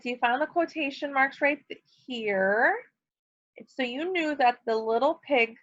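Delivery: normal talking voice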